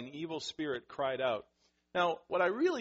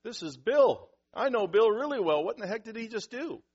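high, raised-voice bewilderment; startled onlooker; 8 semitones higher, 10 dB louder than usual. Acted. Synagogue onlooker reacting to Bill's outburst, startled. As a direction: act baffled and taken aback, pitched up.

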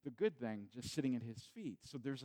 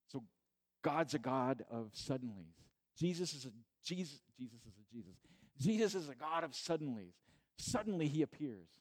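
normal talking voice

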